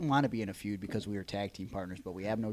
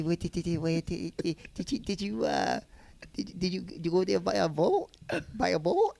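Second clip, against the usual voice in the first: high, stammering mock voice; acquaintance impression; 8 semitones higher, 4 dB louder than usual. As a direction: keep tripping over the question in a raised voice. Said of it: "this is how he talks".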